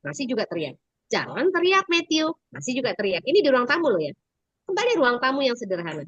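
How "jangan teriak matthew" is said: mother's scolding. Retold or imitated. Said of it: "Kan mamanya bilang".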